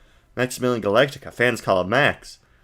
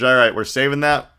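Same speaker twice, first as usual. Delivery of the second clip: ordinary delivery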